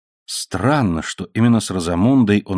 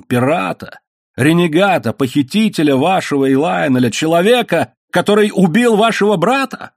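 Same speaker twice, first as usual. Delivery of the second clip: higher-pitched listing, climbing to the end; outraged; 8 semitones higher than usual. A narrator's reading. Man denounces her lover as brother's killer, outraged. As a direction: pile up each accusation in rising indignation.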